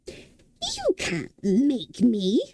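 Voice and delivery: slow, hissing whisper of a stubborn fire; crackling fire demon. A narrator's reading. Fire demon Calcifer defies Sophie, crackling slow whisper. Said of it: "crackled the fire demon".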